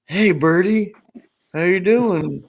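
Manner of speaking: high lazy stoner drawl; lightly voiced stoner greeting a bird; Chong stoner voice. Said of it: "Chong goes up to the cage to the bird and says".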